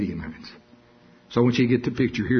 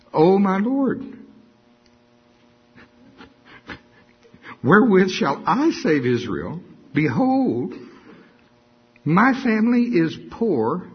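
pleading Gideon; 7 semitones higher than usual. Acted. Gideon pleads with the Lord, high and whiny.

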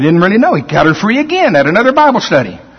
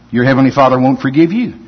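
normal talking voice

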